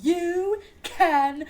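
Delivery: very slow, drawn-out haughty dismissal; Meredith Marks accent. Retold or imitated. S1 imitating Meredith Marks dismissing the Duchess, slow and haughty. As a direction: drag every word out like a reality star. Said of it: "in her fucked up accent and goes".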